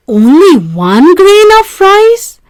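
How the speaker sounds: high, loud astonished question; can't believe the request is so small; exclaimed. A narrator's reading. Raja astonished at Rani's tiny request, exclaiming. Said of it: "exclaimed the Raja".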